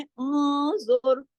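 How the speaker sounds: ऊंजुर very slow with a heavy, full ज़ो. Shown as a demonstration. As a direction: recite ऊंजुर with thick ज़ो and nasal ऊं. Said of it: "ज़ो मोटा". (ऊंजुर को मोटे ज़ो के साथ)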